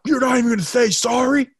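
indignant demand. Retold or imitated. Guy berating woman, retold.

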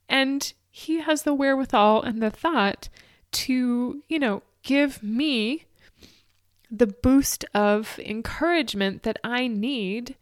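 high, tearful, breaking voice; real tears pushing the words out; crying. Real, not acted. Recalling Ben's encouragement, openly crying. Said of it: "I still get emotional thinking about it now".

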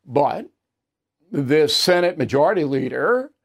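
ordinary talk

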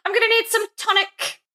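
very high mock British, breaking into laughter; giggly put-on British voice; fake British accent. Podcaster playing along as the sufferer, fake British accent. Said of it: "like, a fake British accent on the podcast".

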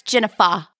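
"Jennifer" said as Jennifa, ending in an open ah with no R. Shown as a demonstration. Friend teasing Jen about her name, "Jennifer" said Jennifa. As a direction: say "Jennifer" as Jennifa, final syllable fah without R. It ends with an A.

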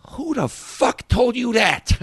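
high, loud, slow incredulous snarl; a menacing Pesci challenge, drawn out; Joe Pesci tough guy. Retold or imitated. Imitating Joe Pesci to the narrator, loud menacing challenge. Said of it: "he looks at me and he goes".

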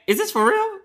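high-pitched mock disbelief; incredulous reaction. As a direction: retell it as a baffled, high-voiced question. Retelling a shocked first reaction to cover.

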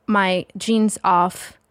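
ordinary talk

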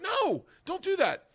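high, slow, drawn-out no; retold protest. Segura stops Adam in story.

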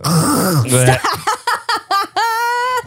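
long, high-pitched laughing fit; helpless laughter. Real, not acted. Podcast host losing it mid-sentence, helpless laughter. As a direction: burst out laughing, unable to stop.

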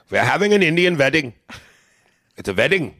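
mock Indian accent with V for W. Shown as a demonstration. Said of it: "replace the W with a V".

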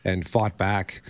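quietly choked up, holding it together; steadying shaky voice. Real, not acted. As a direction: emotion creeping in, voice dropping. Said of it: "I'm getting emotional thinking about this stuff".

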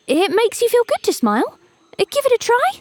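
bright little bird calling out; eager cuckoo voice. A narrator's reading. Cuckoo replies.

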